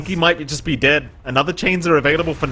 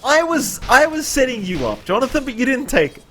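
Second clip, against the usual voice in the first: teasing; 8 semitones higher than usual. Real, not acted. Teasing partner about joke.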